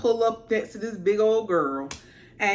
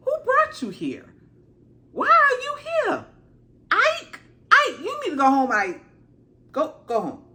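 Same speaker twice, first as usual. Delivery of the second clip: hammed-up scolding; mock scolding; 12 semitones higher than usual. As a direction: shoo him home like a fussing auntie. Scolding the dragonseed to leave.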